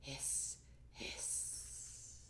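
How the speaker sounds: very soft unvoiced hiss; kettle hiss. The tea kettle, a soft hiss. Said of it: "tea kettle whistled".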